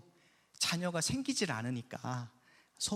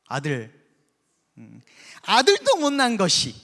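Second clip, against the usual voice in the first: much higher and much louder, a vicious jeering insult; harsh taunt; 5 semitones higher, 10 dB louder than usual. Acted. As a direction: spit out a cruel taunt at the childless wife. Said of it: "너무 센 거 아니에요".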